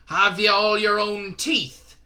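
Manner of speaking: put-on slow loud mixed British regional accent; a drill sergeant's regional accent; Shadwell's wandering accent. Shadwell interviewing Newton in a regional accent. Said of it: "accent was unplaceable".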